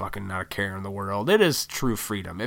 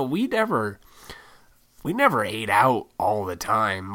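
usual speaking voice

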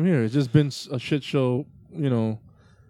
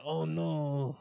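put-on Cuban accent; 4 semitones higher than usual. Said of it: "That's my Cuban accent".

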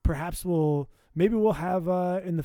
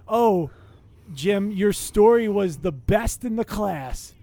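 sarcastic; 4 semitones higher, 8 dB louder, slower than usual. Retold or imitated. Mocking a fake compliment.

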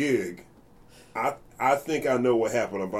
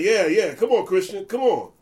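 higher, louder, jeering drummer; imitating Victor egging him on; 9 semitones higher, 8 dB louder than usual. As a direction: pile on with mock encouragement. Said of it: "he's like".

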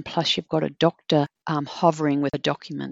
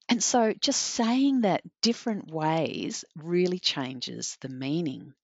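ordinary talk, nothing marked

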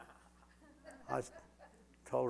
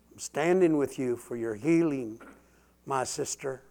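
louder, declaring support; rising preacher declaration; preaching build; 5 dB louder than usual. Real, not acted. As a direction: proclaim it to the sister.

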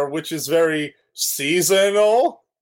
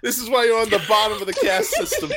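laughing mock scolding; 7 semitones higher, faster than usual.

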